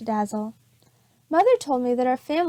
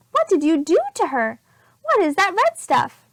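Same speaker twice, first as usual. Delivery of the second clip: louder, rising alarmed questions; upset mother; 5 semitones higher, 5 dB louder than usual. Acted. Missus Lane questioning Amelia about the mess, upset. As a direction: demand an answer, rattled.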